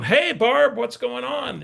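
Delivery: bright, high, overly friendly hello; fake cheery greeting. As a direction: sound thrilled to hear from her, bluffing. Faking he knows the caller.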